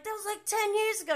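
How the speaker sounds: high, fast, whiny mock of James; mocking James, high and quick. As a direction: brush it off in a squeaky parody. Mocking James's dismissive reply.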